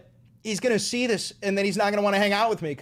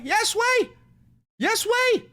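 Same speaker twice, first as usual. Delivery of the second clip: much higher, shouted yes way; 12 semitones higher, slower than usual. Real, not acted. To a doubting crowd, a high shouted yes way.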